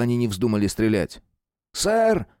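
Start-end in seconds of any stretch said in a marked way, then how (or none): 1.7-2.3 excited exclamation. A narrator's reading. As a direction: call out high and breathless. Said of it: "воскликнул один из тех солдат". (взволнованный возглас)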